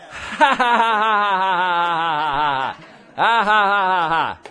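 long loud put-on ha-ha laugh; demonstrative laughing. Shown as a demonstration. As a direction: laugh right at the problem, demonstrating. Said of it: "laugh at it".

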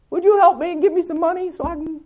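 high, quick mock plea from a needy caller; pleading caller. Sharon's caller on the phone, begging for money in a mock high voice. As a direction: whine it out fast, asking for money. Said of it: "called her up on the phone and asked for help".

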